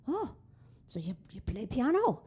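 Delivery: put-on Irish cop, pitch swooping up; Irish policeman. Irish policeman notices the piano instead of the broken window, intrigued. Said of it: "up comes the Irish policeman".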